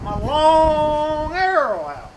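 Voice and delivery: LONG held high and loud for ages; "long" sung out slowly, then "arrow out" falling away; drawn-out long. Acted. Comic stretching of long arrow.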